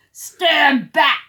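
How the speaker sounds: big loud booming command. Acted. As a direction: boom it out to silence the crowd. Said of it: "boomed a voice".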